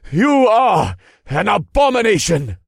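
high, loud outraged shout; crying out. A narrator's reading. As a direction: denounce the enemy at full voice. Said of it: "he cried out".

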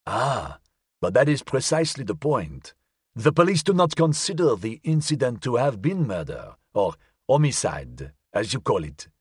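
ordinary delivery

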